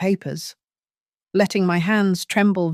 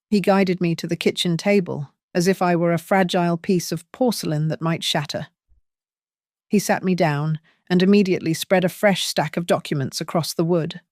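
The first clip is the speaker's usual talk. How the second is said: ordinary delivery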